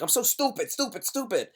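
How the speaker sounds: high, frantic self-berating voice; a guilty person scolding themselves, high-pitched. Retold or imitated. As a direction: beat yourself up in a high voice. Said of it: "They're like".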